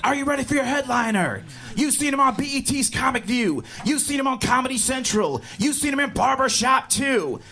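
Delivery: club host's intro. Retold or imitated. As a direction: hype the crowd for the headliner. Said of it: "The host was like".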